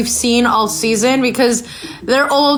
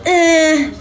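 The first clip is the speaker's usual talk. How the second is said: hesitant; slower than usual. Retold or imitated. Podcaster imitating Gary asked if he's in love, hesitant. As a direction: stall on a noncommittal noise, then concede. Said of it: "And he hesitated".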